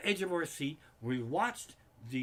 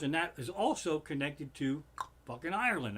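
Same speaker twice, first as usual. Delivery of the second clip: ordinary delivery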